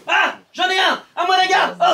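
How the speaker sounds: high shouted alarm call; panicked shout. Shown as a demonstration. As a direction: yell for the guard in panic. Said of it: "tu commences donc par un cri".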